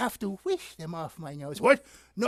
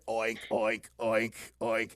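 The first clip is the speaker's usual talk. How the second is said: low, evenly spaced oinks; pig oinks; 9 semitones lower, 4 dB quieter than usual. Acted. Singer gives the old piggy's answer, low pig oinks. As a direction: drop low for the pig's oinks. Pig's part in farm song.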